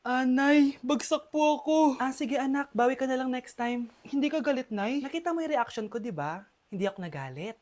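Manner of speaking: ordinary talk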